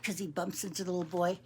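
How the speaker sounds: ordinary delivery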